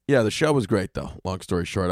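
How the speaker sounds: normal talking voice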